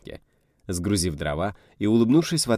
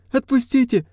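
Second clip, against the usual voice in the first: shrill plea; 7 dB louder than usual. A narrator's reading. Captive begs to be released.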